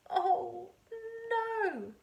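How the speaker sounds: high, loud oh no; crying out in dismay. Acted. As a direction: wail it in dismay. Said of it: "cried George".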